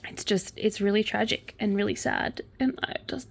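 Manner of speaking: soft, real sadness; sorrowful. Real, not acted. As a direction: speak quietly through the sorrow. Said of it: "can hear the sorrow in my voice".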